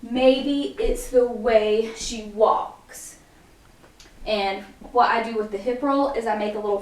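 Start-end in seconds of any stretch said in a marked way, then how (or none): 0.0-3.1 very slow, drawn out in time with moves; slow counted lyric. Shown as a demonstration. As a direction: stretch every word across the beat. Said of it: "And you're just gonna go".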